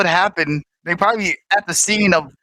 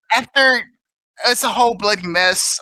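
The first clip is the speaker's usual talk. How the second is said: normal talking voice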